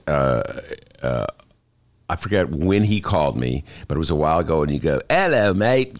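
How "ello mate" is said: higher-pitched mock British greeting; British accent. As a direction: put on a jokey British accent. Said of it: "That's my British accent, sorry".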